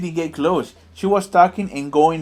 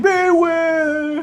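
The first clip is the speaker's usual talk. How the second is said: high shouted eerie warning; mystic voice; 5 dB louder than usual. Retold or imitated. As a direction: boom it out like an oracle. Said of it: "She goes like".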